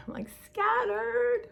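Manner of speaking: high, slow, drawn-out scattered. Real, not acted. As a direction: stretch it high like your head is spinning. Describing coming home tired.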